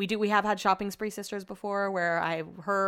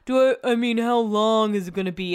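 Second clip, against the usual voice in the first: lightly imitated whiny grumble, a bit louder; put-on complaint, lightly pushed; mock complaining; 3 dB louder than usual. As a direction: mildly mimic a husband griping. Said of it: "Brett's complaining about the concert".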